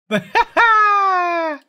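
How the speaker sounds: sudden squealing laugh; high burst of laughter. Real, not acted. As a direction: break into loud high laughter. Laughs at the scam. (громкий высокий смех)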